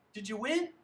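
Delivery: high, loud piping question. A narrator's reading. Polly interrupts the dinner questions, piping up. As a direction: chirp it out like an eager sibling. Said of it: "when Polly pipes up".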